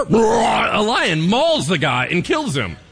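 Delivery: loud, slow, half-crying outburst; dramatic outcry. Acted. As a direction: wail it out as the lion strikes. Dramatic retelling of lion attack.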